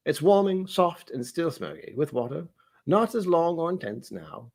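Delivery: measured English butler reading tasting notes; English butler accent. Acted. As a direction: read it out in a put-on English accent. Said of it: "some kind of English butler".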